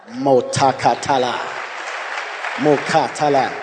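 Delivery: slow, separated syllables of glossolalia; short deliberate bursts of tongues; speaking in tongues. Real, not acted. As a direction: break into tongues, slowly.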